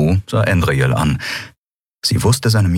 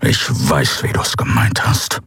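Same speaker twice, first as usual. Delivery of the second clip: low, voiceless hiss; hissed anger; 5 semitones lower than usual. A narrator's reading. Kero answers Endriel's apology, hissing angrily. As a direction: hiss it back, wounded. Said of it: "fauchte er".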